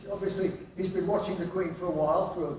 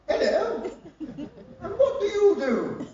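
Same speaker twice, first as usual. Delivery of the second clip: much higher, slow posh Queen voice; Queen impression; 12 semitones higher, 4 dB louder, slower than usual. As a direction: play the Queen graciously greeting. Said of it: "looks at you and goes".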